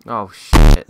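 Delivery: sudden loud curse at the broken ladder; startled shout. Real, not acted. Gamer finds the ladder broken, sudden loud curse. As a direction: blurt it out loud, caught off guard.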